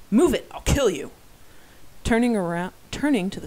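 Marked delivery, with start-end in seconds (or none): 0.1-1.1 loud snarled threat, clearly louder; threatening shout. Acted. Robber charging at the crowd shouts a threat. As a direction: bark it at the crowd as a knife-wielding robber.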